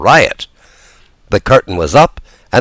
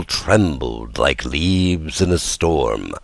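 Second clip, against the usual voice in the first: ordinary delivery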